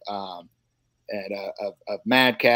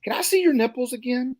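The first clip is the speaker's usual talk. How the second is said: lady voice; 12 semitones higher than usual. Retold or imitated. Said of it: "And she goes".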